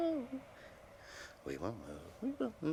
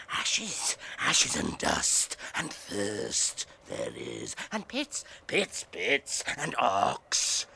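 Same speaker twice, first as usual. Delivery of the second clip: slow dread; 8 semitones lower, slower than usual. Acted. Gollum describes Mordor with dread.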